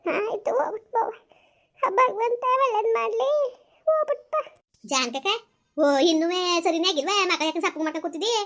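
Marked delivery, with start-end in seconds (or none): 0.1-4.4 put-on high, crying old woman's voice; tearful blessing. Mother-in-law sending daughter-in-law off, crying high. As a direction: bless her through tears, pitched up.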